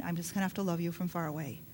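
very soft, quick thought under the breath; inner thought. Shown as a demonstration. Preacher voicing a private thought, barely audible. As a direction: think it, don't say it, fast and low. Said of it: "think to yourself in your mind".

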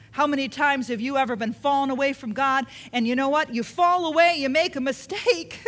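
ordinary talk, nothing marked

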